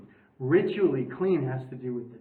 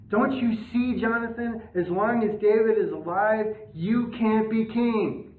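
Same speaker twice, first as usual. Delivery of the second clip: insistent Saul; 7 semitones higher, 4 dB louder than usual. Retold or imitated. Saul pleading with Jonathan.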